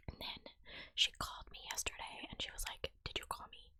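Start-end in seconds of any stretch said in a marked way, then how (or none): 3.0-3.7 mock-snippy retold question, lightly done; a touch of curt irritation. Mother on the phone to her daughter, mildly snippy imitation. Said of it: "Like, real snippy-like".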